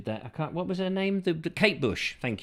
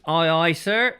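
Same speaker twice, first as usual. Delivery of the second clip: sailor voice; 6 dB louder than usual. Pretend. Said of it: "preferred by sailors".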